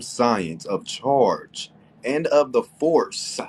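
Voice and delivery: science of charge and of the force read slow and loud; stress on force. Shown as a demonstration. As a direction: lean hard on force, slow and deliberate. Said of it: "emphasis on force".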